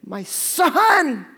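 slow, high pleading outcry; a grieving father crying out to the oblivious passengers; slow, rising, loud anguish. Retold or imitated. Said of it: "just wanting to scream".

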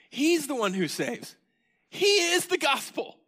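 high, loud proclaiming; ringing preacher's declaration; preached loud. Real, not acted. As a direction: cry it out to the congregation. Preacher climaxes the point.

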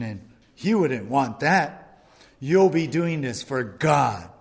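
furious tempter stirring up revenge; Satan inciting. Retold or imitated. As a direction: whip them up to strike back. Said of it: "maybe he'll even quote the Bible".

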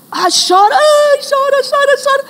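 high mock sobbing chant; mock crying. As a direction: imitate a whining crying child. Mocking child crying.

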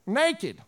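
put-on "Naked" as a high, drawn NAY-kid; pointed pronunciation. Shown as a demonstration. Pastor settling the question for laughs, "Naked" as high NAY-kid. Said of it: "How do you say it?".

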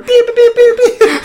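very high, loud laughing beeps; squeaky mock electronic beeps; imitated beeping. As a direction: mimic a tape machine beeping. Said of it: "He has another tape recorder".

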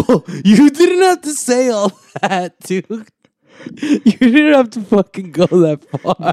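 high, slow mock disbelief; mock shocked. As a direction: act scandalized by the praise. Teasing friend's praise of rival.

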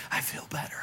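soft unvoiced whisper, barely there; faint whispered relief. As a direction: breathe it out weakly. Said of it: "she's saying it in a whisper".

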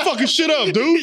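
high, heated cheerleader heckle; mock yelling. Cheerleader to the football players, mock yell. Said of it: "You're yelling at the football players".

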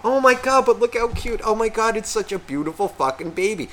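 high cooing mock admiration; gushing relative. Relatives breaking off the argument to admire baby, gushing. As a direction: switch to a high, doting baby-fuss voice.

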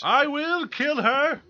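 high, loud, slow menacing vow; hammed-up movie villain threat; dramatic Dune quote.

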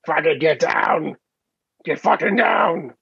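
slow gruff soldier bark, lightly done; Marcus yelling. Retold or imitated. Father yelling at his son in the game, a mild mock bark. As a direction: bark the order slowly like a grizzled soldier. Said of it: "He's like".

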